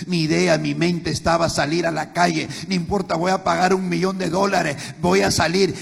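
rising chanted sermon that ends crying out; preaching at full force. Acted. Pastor cries out his failures to the congregation, building to a shout.

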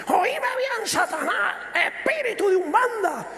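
imitating the preacher on the cassette casting out spirits; fiery preacher rebuke. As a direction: thunder at the devil like a revival preacher. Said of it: "se le salta la cadena y dice".

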